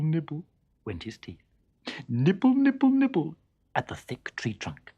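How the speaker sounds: ordinary delivery, nothing marked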